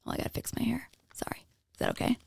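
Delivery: softly whispered off-mic aside. Real, not acted. Podcast host whispering an aside while fixing hair. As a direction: whisper it as if stepping away from the mic.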